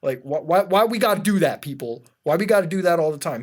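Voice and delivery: loud, incredulous complaint; exasperated rant. Real, not acted. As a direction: throw up your hands and yell why. Ranting at supremacy videos.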